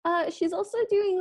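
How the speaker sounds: normal talking voice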